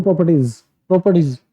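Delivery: Properties said short and quick; quick delivery. Shown as a demonstration. Teacher showing quick word delivery, properties said fast. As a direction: get through properties quickly, no stretching. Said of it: "जल्दी से उसको deliver कर देना है".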